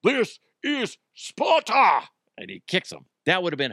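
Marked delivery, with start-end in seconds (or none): 0.0-2.0 high, slow, loud mock war cry; hammed-up movie battle cry; Leonidas shout. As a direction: bellow it like a movie Spartan king. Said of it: "compared it to Leonidas".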